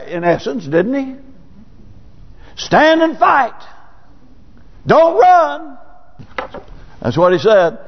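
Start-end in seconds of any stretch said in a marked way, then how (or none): 2.5-5.8 high, slow ringing command; the Lord's command. Pretend. The Lord ordering the Smyrna church to hold firm, a high, slow command. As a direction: call it out like a divine order, drawn out.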